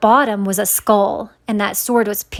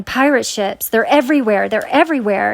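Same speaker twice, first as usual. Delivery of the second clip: usual speaking voice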